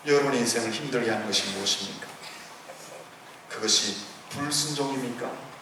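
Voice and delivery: usual speaking voice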